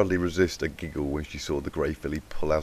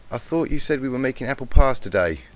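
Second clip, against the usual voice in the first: put-on higher, louder country accent question; 7 semitones higher, 9 dB louder than usual. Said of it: "with a country-like accent".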